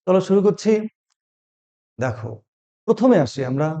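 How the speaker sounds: normal talking voice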